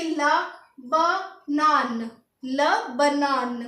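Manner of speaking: ल बनान split into syllables, then slowly joined; slow French pronunciation. Shown as a demonstration. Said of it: "pronunciation लिखेंगे हम".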